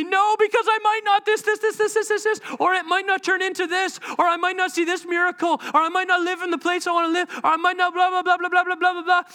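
put-on high, childlike stream of excuses; mock whiny protest. Believer refusing God's request like a child, mock whiny protest. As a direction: rattle off objections in a high whine. Acted childish objections to God.

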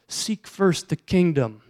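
slow emphatic. Acted.

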